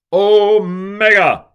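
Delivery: usual speaking voice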